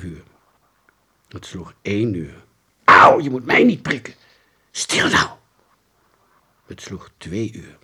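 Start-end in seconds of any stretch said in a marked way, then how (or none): 2.8-4.1 high, loud yelp of complaint; pained protest. A narrator's reading. Pricked child protests. 4.7-5.3 forceful stage whisper; hushing order. A narrator's reading. Boy hushes the complainer.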